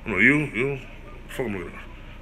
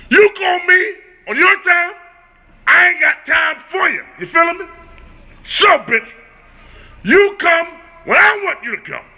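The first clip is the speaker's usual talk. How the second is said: angry rant; 6 semitones higher than usual. Real, not acted. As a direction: tell her off at full volume. Ranting at the girl who calls.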